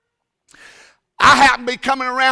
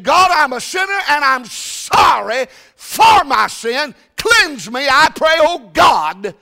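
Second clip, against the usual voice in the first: loud prayer; 6 semitones higher, 5 dB louder, slower than usual. Retold or imitated. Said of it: "I knelt down and said".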